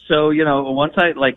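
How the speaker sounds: normal talking voice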